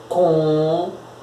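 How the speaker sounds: "Computador" broken by long pauses, COM... PU... TA... DOR; syllable by syllable. Shown as a demonstration. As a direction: hold each syllable of "Computador" and wait before the next. Separa a palavra em sílabas. ("Computador" sílaba por sílaba, com pausas longas)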